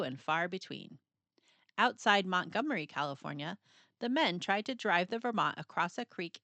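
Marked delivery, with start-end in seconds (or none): none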